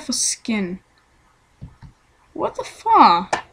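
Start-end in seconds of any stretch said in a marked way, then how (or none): 2.3-3.4 surprised. Real, not acted. Shocked at his strange skin.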